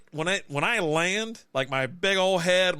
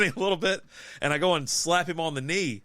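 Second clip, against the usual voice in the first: ordinary talk, nothing marked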